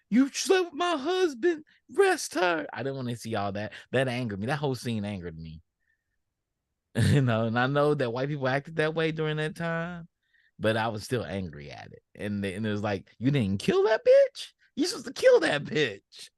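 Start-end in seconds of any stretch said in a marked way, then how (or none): 0.1-2.7 very high, louder mock accusing wife; mock shrill wife. Mocking a character's scene. 13.2-16.3 very high, louder mock outrage, slowed; mock outraged yell. Acting out what character should say.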